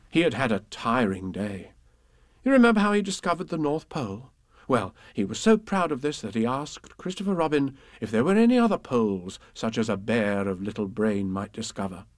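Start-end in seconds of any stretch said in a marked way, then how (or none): none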